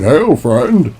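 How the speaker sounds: lightly voiced game orc greeting; a hint of a stock orc saying hello. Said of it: "the fucking orcs that just keep going".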